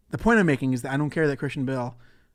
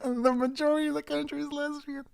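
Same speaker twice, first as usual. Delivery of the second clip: giggling through the words; can't stop laughing; 12 semitones higher than usual. Real, not acted. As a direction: laugh it out high and breathy. Laughing while reading clip.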